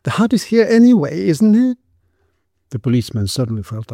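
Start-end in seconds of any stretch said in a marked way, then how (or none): 0.0-1.8 very high woman's voice; shrill, questioning protest. A narrator's reading. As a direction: pipe up defensively, far above normal pitch. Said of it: "in her shrill voice".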